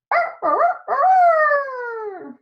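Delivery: very high, loud crowing call; rooster crow. Acted. Rooster crows loudly at the end of the day.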